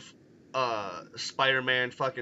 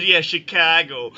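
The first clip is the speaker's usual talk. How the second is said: put-on Chicago accent; 4 semitones higher, 8 dB louder than usual. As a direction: lay on a thick Chicago accent. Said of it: "You sounded like a Blues Brother".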